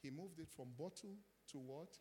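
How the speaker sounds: normal talking voice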